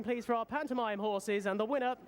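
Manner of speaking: usual speaking voice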